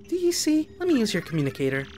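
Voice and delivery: high, quick game voice; character voice. Acted. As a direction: voice the robot character.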